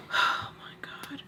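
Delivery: sigh, then a slow whispered "oh my God"; whispered dread. Acted. Vlogger realizing she'll hear about it later, whispered dread. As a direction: breathe it out voicelessly, dreading what follows.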